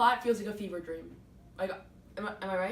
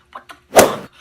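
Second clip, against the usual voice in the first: sudden loud mock-outraged shout; loud comic rage; furious outburst; 18 dB louder, slower than usual. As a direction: blow up at the failed plan. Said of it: "Medea's like".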